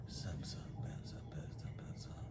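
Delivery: whispered mumble imitating low-talkers; imitating dudes who speak low, all breath; mumbled low greeting. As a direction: barely voice the greeting. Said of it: "And I found myself speaking low".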